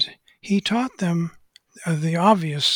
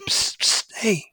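furtive psst; 5 semitones higher, slower than usual. Retold or imitated. Hidden little man gets his attention.

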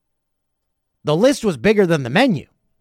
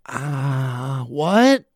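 ordinary talk

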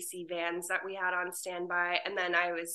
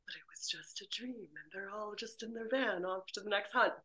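soft unvoiced whisper of a twist ending; 9 dB quieter than usual. Telling a pitched story ending, whispered. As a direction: breathe it like a secret reveal.

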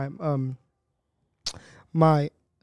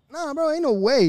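high disbelief; 10 semitones higher, 4 dB louder than usual. Real, not acted. Disbelief at size.

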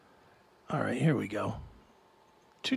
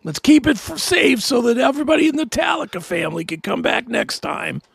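higher, cheesy safety reminder; mock friendly frontman; 7 semitones higher, faster than usual. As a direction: parody of a caring rock star. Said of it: "is now the".

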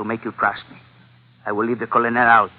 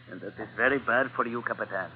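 soft menace; 10 dB quieter than usual. Acted. Quiet threat at gunpoint.

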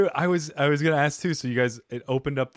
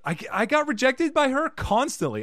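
much higher embarrassed voice; sheepish squeak; 12 semitones higher, 4 dB louder than usual. Real, not acted. High sheepish admission.

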